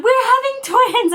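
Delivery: very high, loud squeal; retold high-pitched giddy reveal; excited announcement. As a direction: blurt out the big news in a squeal. Retelling big news to mom.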